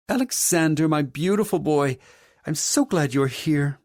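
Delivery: grand mother character. A narrator's reading. Mother makes an entrance greeting her son, effusive character voice. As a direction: gush over your son in a grand, high voice. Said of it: "My mother's deep voice".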